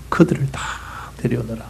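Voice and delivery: hushed, urgent whisper; whispered command. Acted. Master quietly sending servant to the gentiles, whispered. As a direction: whisper the order intently.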